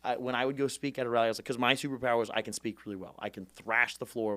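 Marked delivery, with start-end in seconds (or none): none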